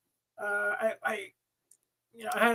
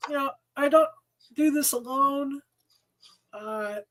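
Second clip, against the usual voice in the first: normal talking voice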